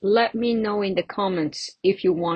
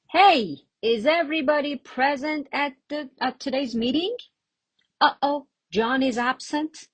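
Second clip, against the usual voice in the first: higher-pitched, lively check on who's here; acted meeting roll call; 7 semitones higher than usual. Shown as a demonstration. Chairperson asking the team who is at today's meeting, acted out brightly. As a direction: act out checking attendance, pitched up.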